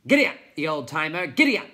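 high, loud, fast urging; shouting giddy up. A narrator's reading. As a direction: spur the snail on, shouting. Urging on his snail.

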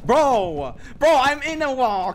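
high, loud protest at the game; outraged yell. Real, not acted. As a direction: shout in disbelief. Dragged into wall, outraged.

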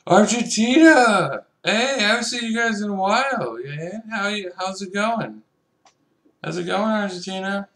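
high, loud happy hello; excited greeting. Real, not acted. Gamer greeting the Argentina player excitedly.

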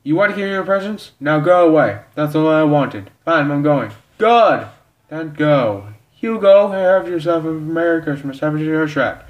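loud, high angry yelling; shouted quarrel. Acted. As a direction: yell it as a furious send-off. Quarrel as guests are thrown out.

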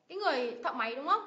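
ordinary talk, nothing marked

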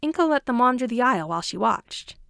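usual speaking voice

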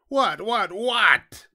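high, loud, repeated demand; impatient shout. A narrator's reading. Fox fed up with the piglet stalling, shouting high. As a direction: shout it, building to the last word. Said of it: "shouted the fox".

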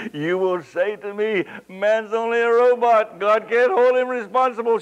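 loud, high, indignant quote; mock objection. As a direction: act out the whining objector. Quoting the objector from scripture.

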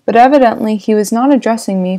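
ordinary delivery

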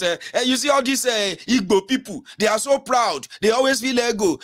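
high-pitched imitation of a bigoted grumbler; mock tribalist complainer. Someone complaining to the host about Igbo people, mock indignant. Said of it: "somebody come and they start saying that".

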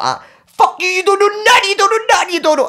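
high-pitched squealed jeer; acted-out squealing tirade; manic taunting. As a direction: chant it at him in a frantic high voice. Acting out his manic taunting.

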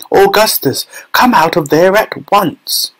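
high, slow imperious call. A narrator's reading. Mother calling her son out of the pipe.

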